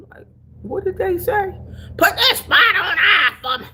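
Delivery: high, loud mock Southern country voice, laughing through it; imitating Southern country folks, high; country accent. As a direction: play the country talker, half laughing. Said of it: "I hear them country people talking".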